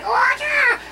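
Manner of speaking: very high hammed-up yell of triumph; mock battle cry. Imitating the fighter charging the cooks, hammed-up high yell. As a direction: crow it like a movie fighter charging in. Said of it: "He's like".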